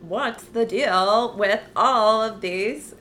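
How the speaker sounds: drawn-out comic rant; stand-up comedian impression. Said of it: "mimic someone without revealing who it is".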